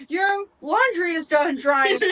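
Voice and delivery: slow, high Alexa announcement voice; cheerful talking appliance. Retold or imitated. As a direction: mimic a smart speaker alert, slow and high. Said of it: "my freaking Alexa will go".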